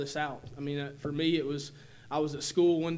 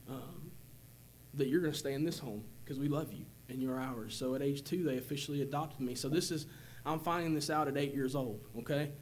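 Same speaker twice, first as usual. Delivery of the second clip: ordinary talk, nothing marked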